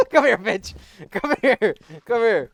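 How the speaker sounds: loud, high, repeated yell; chasing shout. Real, not acted. Player chasing a target in game, loud taunting yell. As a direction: yell it after someone getting away.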